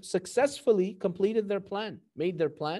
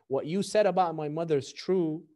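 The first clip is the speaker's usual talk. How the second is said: ordinary delivery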